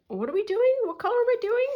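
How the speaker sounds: high, loud baffled question; confused. Retold or imitated. Knitter mixing up colours, high bewildered question. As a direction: exclaim as if completely lost.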